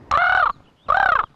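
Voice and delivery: two loud, high, harsh caws; crow caw. Retold or imitated. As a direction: caw twice like a crow on a pole. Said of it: "he said".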